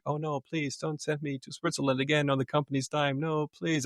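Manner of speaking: high-pitched sham protest; mock pleading. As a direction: beg insincerely, pitched well up. Mock plea about being sent away.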